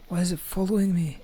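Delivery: high, fast, frightened question; panicking gamer. Real, not acted. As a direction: panic as it keeps chasing you. Monster still chasing.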